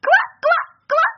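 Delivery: very high laughing ribbits; laughing croak. A narrator's reading. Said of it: "засмеялась лягушка".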